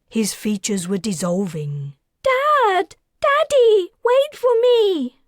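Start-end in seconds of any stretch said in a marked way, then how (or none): 2.2-5.2 very high, loud cry after her father; desperate scream. A narrator's reading. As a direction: scream for Daddy as he vanishes. Calling after vanishing father.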